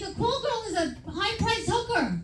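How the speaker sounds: ordinary delivery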